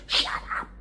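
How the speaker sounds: hissed whisper; stern whispered hush; whispered shut up. Retold or imitated. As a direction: whisper it sharply to silence her. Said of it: "I would be like".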